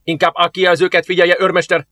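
first officer snapping at his sergeant; rebuking. A narrator's reading. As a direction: put the sergeant in his place. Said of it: "szólt rá az első tiszt".

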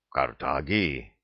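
low, slow, stunned echo; dumbfounded. A narrator's reading. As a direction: repeat the word slowly in a low, stunned voice. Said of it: "повторил ошеломлённый до зубной боли старейшина". (ошеломлённо, низко и медленно)

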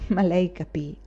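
plain reading voice

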